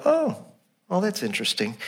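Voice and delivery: a sudden high "Oh" that drops flat and soft; mock unimpressed disciples. Preacher voicing the disciples shrugging at John, mock indifference. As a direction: sound mildly curious, not moved. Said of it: "and the disciples go".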